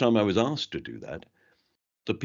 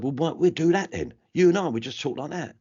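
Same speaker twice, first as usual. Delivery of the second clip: higher-pitched, lively London talk; London accent; 9 semitones higher, 3 dB louder than usual. Retold or imitated. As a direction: put on chummy London speech. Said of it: "I got to do my London accent".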